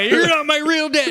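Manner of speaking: put-on high, loud bratty teenager whine; whiny teen yell. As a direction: throw a teenage tantrum at dad. Mocking teen yelling at father.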